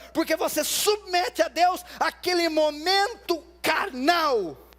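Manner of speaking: impassioned sermon delivery at full force; forceful preaching. Real, not acted. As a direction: preach it hard, pitched high and driving.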